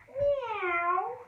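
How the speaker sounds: high mock meow; cat meow. Shown as a demonstration. Kids' yoga teacher meowing as the cat, playfully high. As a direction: play the cat for the kids. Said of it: "we're gonna do the cat".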